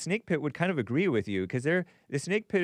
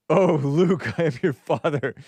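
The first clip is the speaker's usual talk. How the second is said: Darth Vader parody; 3 semitones higher, 7 dB louder than usual. Retold or imitated. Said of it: "flat earth version of Darth Vader".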